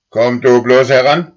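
loud, high threat; threatening cow. A narrator's reading. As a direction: dare the boy to come closer. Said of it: "sagte Mairus".

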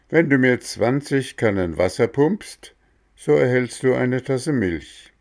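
lightly voiced gardener; Mexle's voice.

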